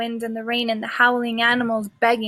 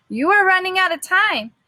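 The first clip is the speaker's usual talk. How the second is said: higher, louder warning; urgent officer warning; 7 semitones higher, 4 dB louder than usual. Acted. The officer warns the staff about the storm, high and urgent. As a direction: press them to hurry.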